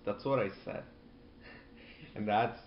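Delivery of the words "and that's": ordinary talk, nothing marked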